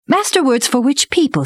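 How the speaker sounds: put-on high-pitched eager boy; delighted show-off. A narrator's reading. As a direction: lightly voice a boy keen to show off. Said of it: "delighted to show off".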